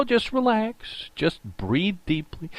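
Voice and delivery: soft and slow, easing fans down; soothing, calming. Real, not acted. Host soothing panicking fans, soft slow calm voice. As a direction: breathe it out slowly, like a calming coach.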